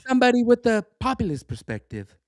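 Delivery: weak put-on British accent for a quote; faltering British accent. Shown as a demonstration. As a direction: read it as a so-so British newsman. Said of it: "my British accent is not very strong".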